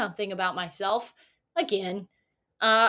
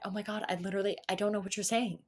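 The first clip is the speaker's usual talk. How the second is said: much softer, half under the breath; muttered inner thought; 4 semitones lower, 9 dB quieter, faster than usual. Retold or imitated. Bewildered customer thinking to herself, much softer. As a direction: keep it to yourself, barely audible.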